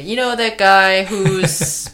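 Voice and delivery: slow, loud, laughing mock description; mock confused viewer. Host mimicking a confused viewer describing characters, slow and loud. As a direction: fumble like someone lost in the plot. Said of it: "So you don't have to say".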